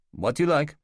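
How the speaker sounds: a lightly done Russian waiter, puzzled; Russian accent. Acted. New waiter questioning the departing guests, in a light Russian accent. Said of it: "the waiter says with a shrug".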